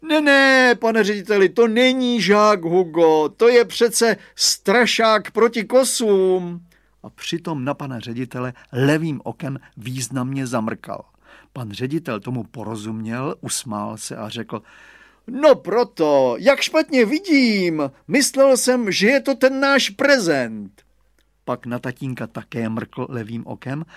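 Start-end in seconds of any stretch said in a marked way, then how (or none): 0.0-6.7 loud, high exclaimed denial; calling out. A narrator's reading. Father covers for Hugo to the headmaster, calling out. 15.3-20.8 high, loud, drawn-out exclamation; headmaster playing along. A narrator's reading. Headmaster pretends he mistook the scarecrow, playing along loudly.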